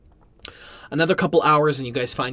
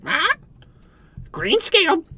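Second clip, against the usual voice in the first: talking bird. Acted. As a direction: squawk the warning like a parrot. Talking bird warns of dragon.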